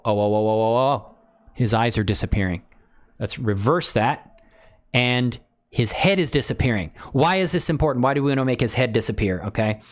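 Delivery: normal talking voice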